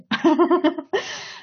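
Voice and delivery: high burst of laughter; high, rippling giggle. Real, not acted. Laughing at own pronunciation attempt.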